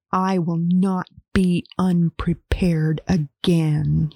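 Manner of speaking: slow whisper through gritted teeth. Acted. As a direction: grit your teeth and hiss it slowly. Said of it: "she said through gritted teeth".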